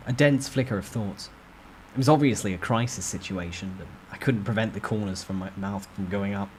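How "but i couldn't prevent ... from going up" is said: usual speaking voice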